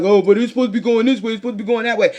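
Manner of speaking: a fast, lightly mocking whine, rushed on the first line; mocking complainer. Mocking the believer who wants things their way, lightly whiny. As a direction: toss off a stubborn believer's complaint.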